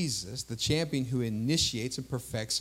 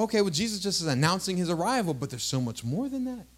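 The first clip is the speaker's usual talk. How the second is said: usual speaking voice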